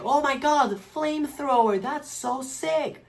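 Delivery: put-on high, stretched-out thrilled kid voice; mock excited kid. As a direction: gush over the flamethrower like a kid. Teacher imagining excited kid.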